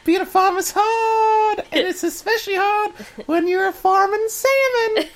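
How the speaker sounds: cartoon fish complaining loudly; talking salmon voice. Continues the salmon voice.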